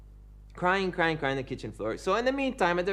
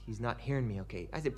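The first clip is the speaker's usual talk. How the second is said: usual speaking voice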